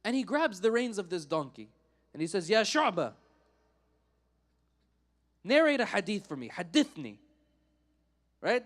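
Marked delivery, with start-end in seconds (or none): 2.5-7.2 loud, rude retold order; rude demand. Drunk man stopping the scholar's donkey to demand a hadith, rudely. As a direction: call out a brusque command. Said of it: "in a very rude way".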